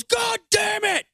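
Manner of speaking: high, slow furious yell; a cop slamming his crowbar down, yelling; angry shout. Retold or imitated. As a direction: bellow it like a cop losing it. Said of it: "and goes".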